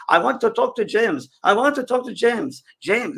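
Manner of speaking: high, loud mock Frenchman calling out; French accent. French spirit at a seance calling for James, high and loud French accent. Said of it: "It's a Frenchman. He goes".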